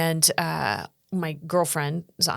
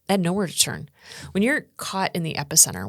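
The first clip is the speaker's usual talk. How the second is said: ordinary talk, nothing marked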